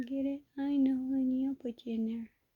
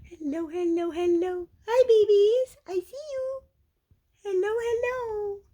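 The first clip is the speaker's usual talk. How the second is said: high, loud squeaky cooing; 10 semitones higher, 10 dB louder than usual. Real, not acted. Calling the kittens out in a high, loud cooing voice. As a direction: coo up high at the babies.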